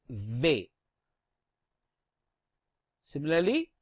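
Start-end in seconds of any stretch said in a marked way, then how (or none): 0.1-0.7 V said biting the lower lip; French V. Shown as a demonstration. Teacher shows learners how to say V, lip bitten. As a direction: bite your lower lip into V. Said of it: "Bite your lower lip and say".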